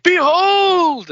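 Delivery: high, loud, drawn-out "Behold!"; mock proclamation. Friend imagining the healed man proclaiming, hammed-up shout. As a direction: belt out "Behold!" like a prophet. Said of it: "imagining him being like".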